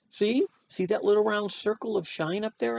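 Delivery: put-on high, eager kid voice; high and coaxing, showing a baby; eager pointing out. A narrator's reading. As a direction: point it out excitedly, pitched high. Child pointing things out to baby.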